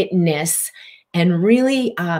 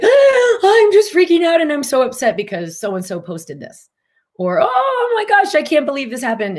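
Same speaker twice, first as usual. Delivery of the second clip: higher, louder, frantic mock panic; overwhelmed friend; 9 semitones higher, 4 dB louder than usual. Teacher imitating an overwhelmed friend, higher and louder in mock panic. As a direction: ham up a friend freaking out over a post.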